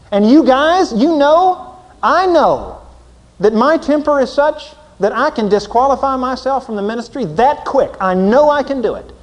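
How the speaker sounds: loud, high preaching; fervent preacher's cry. Acted. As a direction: confess it to the crowd at full force. Preaching at full force.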